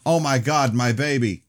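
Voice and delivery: panicked scream at the car; screaming in terror. A narrator's reading. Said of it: "Danielle screamed".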